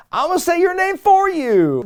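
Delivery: high, loud, hammed-up mock voice; mocking silly voice. Mocking the wrestlers who say names for others, high and loud. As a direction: lampoon them in a goofy put-on voice.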